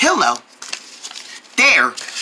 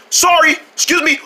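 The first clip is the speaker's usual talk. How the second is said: normal talking voice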